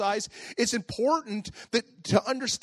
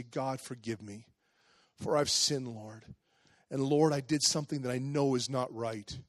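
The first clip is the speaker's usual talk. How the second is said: soft, low confession; contrite prayer; 7 semitones lower, 8 dB quieter than usual. Acted. Sinner before the Lord asking forgiveness, softly contrite. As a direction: confess humbly to God in a hushed, lowered voice.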